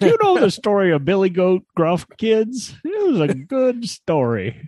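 hammed-up old man telling a tale to kids; old storyteller. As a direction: play the old-timer spinning a yarn, lightly. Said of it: "welcome to the old man party".

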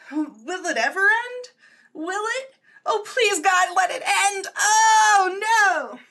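hammed-up anguished shouting; mad scientist. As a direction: wail to the heavens in mock despair. Melodramatic wail about endless Lent.

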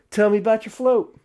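high, emphatic mock-press question; retold eager interview opener; fake interviewer. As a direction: play the keen reporter, voice up.

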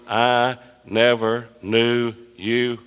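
slow, weighty "I never knew you"; solemn emphatic verdict. Acted. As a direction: press every word, "never" hardest. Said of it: "Jesus emphasized that when he says".

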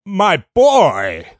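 proud rich man. Retold or imitated. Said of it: "starts referring to Andy as".

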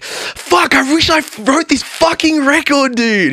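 very high, strained amazement; awed envy. Retold or imitated. Friend hearing the EP in the car, hammed-up envy. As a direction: gush it high, drawing out the words.

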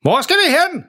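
high-pitched, oblivious yell; deafened shouting. A narrator's reading. Deafened dad denies shouting, high oblivious yell. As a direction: protest in a high holler. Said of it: "holder langsomt op med at råbe".

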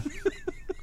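high cackling laugh; wild rambling cackles. Real, not acted. Laughing at shotgun story.

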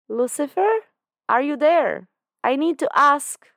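high, loud calling voice; Chloe calling out. A narrator's reading. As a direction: call across the room, pitched high.

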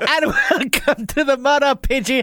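upbeat show opening announcement; podcast host intro. Acted.

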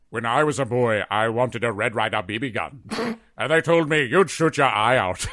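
lightly voiced theatrical storyteller; Patrick Stewart. Shown as a demonstration. Retelling a one-man Christmas Story, lightly voiced stage actor. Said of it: "I always like doing Patrick Stewart".

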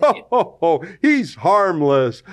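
lightly voiced jolly old grandpa, amused; a hint of a harmless old grandpa laughing it off. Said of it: "that's Grandpa Joe Biden".

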